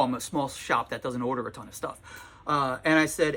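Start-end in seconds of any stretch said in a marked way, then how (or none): none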